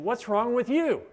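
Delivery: high-pitched accusing question; mock accusation. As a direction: voice a friend's accusing disbelief. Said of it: "And accusation came".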